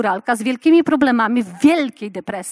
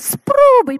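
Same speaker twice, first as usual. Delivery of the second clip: much higher, louder coaxing squeal; enthusing husband; 13 semitones higher, 5 dB louder than usual. Retold or imitated. Husband urging wife to try herring, delighted. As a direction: coax her eagerly to taste it. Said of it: "Mój mąż się zachwyca i mówi".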